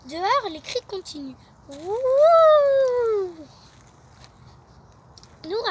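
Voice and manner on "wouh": long high ghostly wooo; ghost wail. Acted. Ghost wailing outside, loud and long. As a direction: howl like a ghost.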